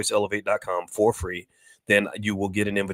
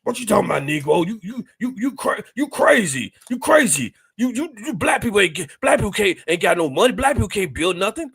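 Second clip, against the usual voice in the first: much higher, louder scoffing voice; mock scoffing naysayer; 11 semitones higher, 4 dB louder than usual. Mimicking a doubter scoffing at building community, high and jeering. Mocking naysayer's scornful reply.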